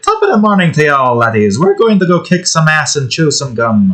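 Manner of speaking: high-pitched, hammed-up Irish accent; exaggerated Irish accent. Shown as a demonstration. Streamer demonstrating an over-the-top Irish accent, exaggerated. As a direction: lay the Irish brogue on thick, starting high. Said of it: "going the exaggerated".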